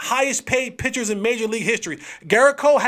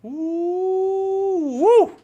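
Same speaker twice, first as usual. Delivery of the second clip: excited whoop; 9 semitones higher than usual. Real, not acted. Host whooping to viewers about the game of the night.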